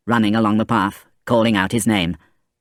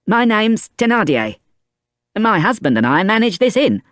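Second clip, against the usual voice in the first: lightly higher woman's voice; introducing herself; 12 semitones higher than usual. A narrator's reading. Innkeeper's wife introducing herself to Fantine, lightly voiced. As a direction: introduce yourself sociably.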